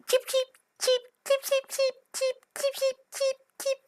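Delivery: very high chirping cheeps; peeping baby chick. Acted. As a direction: peep like tiny chicks.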